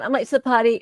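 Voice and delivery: normal talking voice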